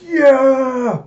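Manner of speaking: high, loud drawn-out whoop; acted battle cheer at full voice; exultant cheer. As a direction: cheer wildly as the charge begins. Character cheers the final charge.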